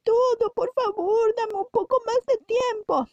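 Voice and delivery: high, fast, pleading voice; begging debtor. Acted.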